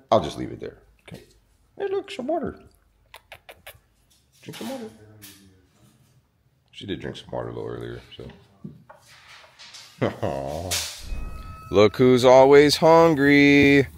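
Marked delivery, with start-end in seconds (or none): 1.8-4.9 very high, soft coaxing to a dog. Real, not acted. Coaxing dog, baby talk. 11.7-13.9 louder, slow affectionate coo; slow loving pet talk; cooing at animals. Real, not acted. Greeting hungry goats.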